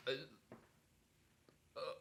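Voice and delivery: ordinary delivery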